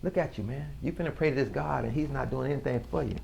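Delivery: a hint of a needling tempter; lightly voiced tempter. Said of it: "the voice say".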